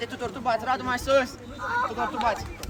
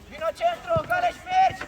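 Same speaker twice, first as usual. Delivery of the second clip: high call; 4 dB louder than usual. Real, not acted. Coach calling player forward.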